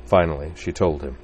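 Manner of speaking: ordinary delivery, nothing marked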